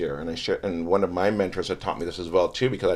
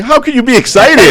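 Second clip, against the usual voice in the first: much higher and louder disbelief; hammed-up incredulous question; 15 dB louder than usual. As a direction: squeal it in mock disbelief. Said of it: "And I'm like".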